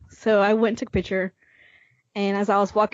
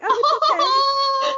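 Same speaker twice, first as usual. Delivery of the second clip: much higher, slow, sing-song reassurance; imitating Jen gently reassuring a nervous fan, high and slow; sweet reassurance; 4 dB louder, much slower than usual. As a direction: coo it kindly to a nervous fan.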